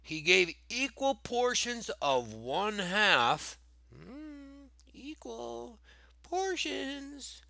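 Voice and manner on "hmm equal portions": very high, soft singsong; playful hint. Pretend. Teacher hinting at key words, high playful voice. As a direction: float the clue out high and teasing.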